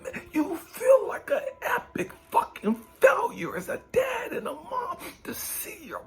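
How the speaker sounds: loud, high, breaking cry; anguished cry. Real, not acted. Preacher crying out to his listeners in raw anguish. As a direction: let the pain burst out. Preacher's grief peaks into shout.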